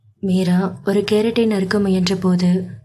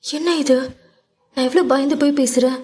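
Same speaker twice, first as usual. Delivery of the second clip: lightly voiced indignant girl, higher pitched; a mild wounded protest; a touch of Meera scolding him; hurt young woman; 6 semitones higher, faster than usual. A narrator's reading.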